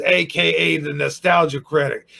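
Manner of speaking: normal talking voice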